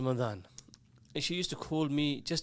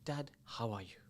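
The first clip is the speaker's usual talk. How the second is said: softly, a touch lower, like a caring son; gentle child's question; 5 semitones lower, 8 dB quieter than usual. Retold or imitated. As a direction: ask it tenderly and quietly. Child asking after a parent.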